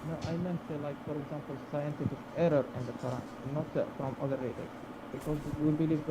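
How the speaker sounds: faint, muffled mic sound; muffled microphone. Real, not acted. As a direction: voice coming through a muffled mic. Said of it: "there's something happening with your microphone being muffled".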